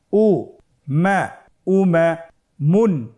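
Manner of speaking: উমামুন built up slowly; slow syllable reading. Shown as a demonstration. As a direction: stretch each syllable for learners. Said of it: "ভেঙে ভেঙে পড়তে হয়".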